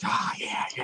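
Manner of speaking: hushed unvoiced delight; whispered glee. Bulls fan reacting to getting Rodman, whispered delight. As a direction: breathe it out in secret joy. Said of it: "I was like".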